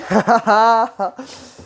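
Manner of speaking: loud, high, gleeful laugh; excited laugh. Real, not acted. Vlogger delighted at his new figure, loud high laughing.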